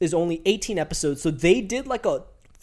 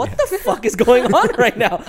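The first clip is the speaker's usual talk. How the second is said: laughing disbelief; 6 semitones higher, 6 dB louder than usual. Retold or imitated. Retelling confused reaction while laughing.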